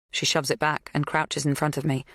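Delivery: plain reading voice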